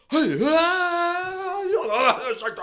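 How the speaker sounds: high, loud, over-the-top strike call; hammed-up umpire yelling strike three; outlandish umpire call. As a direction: bellow it like a showboat umpire. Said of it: "Like, super loud".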